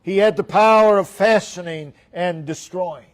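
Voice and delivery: loud, ringing sermon refrain with POWER hit hard; full-force preaching. Real, not acted. Preacher proclaiming the king's power, full-force preaching. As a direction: preach each clause forcefully, falling at the end.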